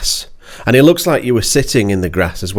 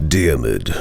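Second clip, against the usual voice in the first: much lower, deep announcer voice on the name; voice-over voice; 10 semitones lower, 3 dB quieter than usual. Pretend. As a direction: say the name like a movie-trailer narrator. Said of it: "I got my, my voice over voice".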